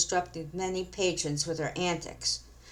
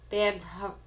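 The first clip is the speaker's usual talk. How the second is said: ordinary delivery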